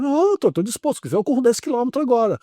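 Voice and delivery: high, keen boast to the doctor; cocky patient insisting he's fine; eager boast. Retold or imitated. Eager reply to doctor, retold.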